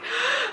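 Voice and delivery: high, sharp gasp; imitated gasp. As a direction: act out someone's excited intake of breath. Imitating the girl's reaction in story.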